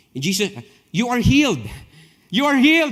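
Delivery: high, loud hammed-up healing cry; mocking televangelist yelling "healed"; mock faith-healer shout. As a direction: play a showy healer from afar.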